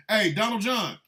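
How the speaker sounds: acted street-style challenge; calling someone out. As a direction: hail him loudly, looking for a fight. Acts calling Trump out to fight.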